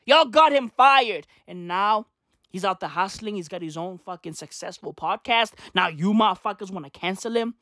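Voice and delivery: ordinary delivery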